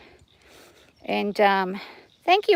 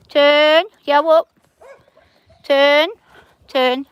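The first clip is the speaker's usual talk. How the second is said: higher, louder rising calls; called command; 4 semitones higher, 6 dB louder, much slower than usual. Real, not acted. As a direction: sing the turn command out. Calling commands to her horse.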